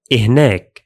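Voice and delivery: إهناك said as "ih-nak", falling at the end; teacher model of إهناك as "ih-nak"; Arabic demo word. Shown as a demonstration. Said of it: "like this".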